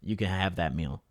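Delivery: smooth lady-killer. Retold or imitated. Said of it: "almost gets me torqued".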